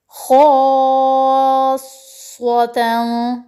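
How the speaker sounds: slowly drawn-out खासतन; six-count madd. Shown as a demonstration. Teacher reciting the madd for students to practise, held long. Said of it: "इसी तरीके से आपने अगले हरूफ की".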